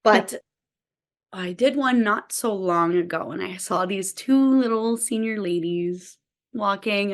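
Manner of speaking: ordinary talk, nothing marked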